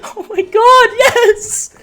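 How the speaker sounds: high, loud scream of disbelief; disbelieving shout. Real, not acted. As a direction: shout it like you can't take it in. Disbelief right after winning.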